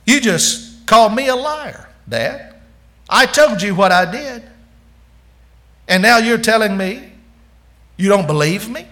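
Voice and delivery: a son stung at being called a liar, pushing back; hurt son protesting. Acted. Son protesting to his doubting father, indignant and loud.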